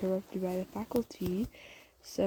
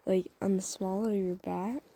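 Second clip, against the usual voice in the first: ordinary talk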